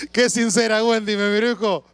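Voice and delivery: high laugh through the words. Real, not acted. Laughing at Wendy's answer.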